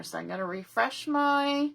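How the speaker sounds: ordinary delivery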